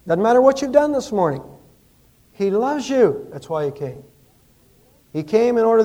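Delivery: fervent preaching climax; impassioned preaching. Acted. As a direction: lift the room, plead with them. Preacher's climax on God's love.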